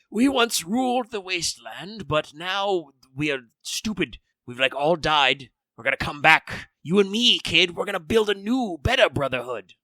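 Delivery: a hint of a frail old man. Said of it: "I'm gonna do an old man voice".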